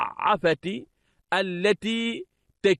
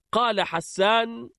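usual speaking voice

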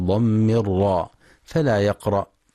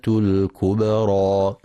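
slow, measured tajweed chant; Quran recitation; 3 semitones higher, slower than usual. Acted. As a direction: recite it slowly and melodically.

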